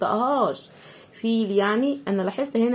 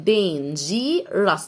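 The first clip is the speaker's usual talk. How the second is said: "dangerous" very slow, dain-jee-rus; split syllables; much slower than usual. Shown as a demonstration. Teacher splits it first for pupils, slow three-part "dangerous". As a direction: break "dangerous" into three separate parts. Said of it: "نقسمها الأول".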